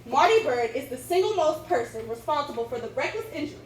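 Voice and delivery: loud, dramatic courtroom oratory; sway the jury with each imagine; grand rich voice. Acted. Formal opening statement to jury.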